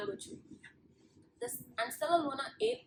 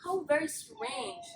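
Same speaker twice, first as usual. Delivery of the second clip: high, slow, drawn-out wondering; murmured wonder; 6 semitones higher than usual. A narrator's reading. Bats react to Stellaluna's story, murmured wonder. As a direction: puzzle over it slowly, pitched up. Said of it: "they all murmured".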